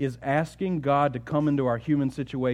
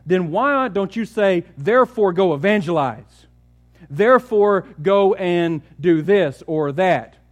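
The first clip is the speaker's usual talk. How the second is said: loud, urgent pulpit voice; full-force preaching; 6 semitones higher, 7 dB louder than usual. Acted. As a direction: press the point hard from the pulpit.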